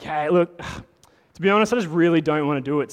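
loud, fast, exasperated protest; blatant refusal. Retold or imitated. Moses bluntly telling God no, loud and rushed. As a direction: blurt it out like a man who's had enough. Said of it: "he's blatantly like".

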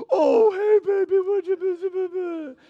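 put-on high, loud cooing baby talk to the dogs; doting dad baby-talking his dogs. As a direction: gush at the little dogs like babies. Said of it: "starts baby talking".

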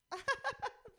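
short high laugh; high burst of laughter. Real, not acted. Laugh before quoting.

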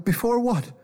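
high scared question; frightened boy. A narrator's reading. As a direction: ask like a startled kid.

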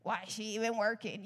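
low, lightly catty imitation; mock complaint. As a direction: imitate petty grumbling, pitched a bit down. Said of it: "sometimes we're like".

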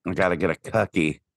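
put-on Midwestern accent. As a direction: mimic Midwestern vowels in "cookie". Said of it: "Midwestern, yeah".

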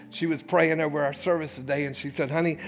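normal talking voice

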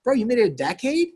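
high, scoffing disbelief; a touch of sarcastic bro voice; mock incredulous friend. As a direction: tease them like a bro who can't believe it. Mocking a couple's friend.

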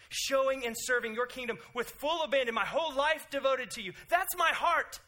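high, loud, fervent prayer; earnest prayer voice. Retold or imitated. Preacher models a devoted prayer, high and fervent. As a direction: pray it with full abandon.

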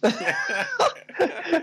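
squealing high laugh. Real, not acted. Laughing at the film scene.

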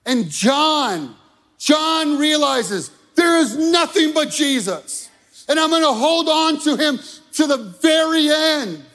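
high, loud and driving, building to a shout; fiery preaching. Acted. Pastor rousing the congregation against the enemy, fiery shouting preach. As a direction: hammer it home to the congregation.

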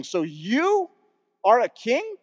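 scornfully incredulous question; disgusted disbelief. Acted. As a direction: lean on "you", then rise sharply on "king" in disbelief. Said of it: "With this disgust and disbelief in there".

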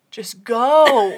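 mock fed-up dismissal; exasperated. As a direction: imitate her at the end of her rope. Acting out her begging him to leave.

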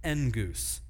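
"Engus" like and-goose blended; Greek engus. Shown as a demonstration. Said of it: "I have no idea what it sounds like in English".

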